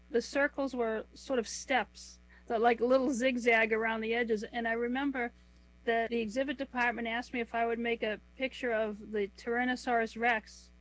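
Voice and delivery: ordinary delivery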